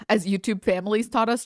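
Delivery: ordinary delivery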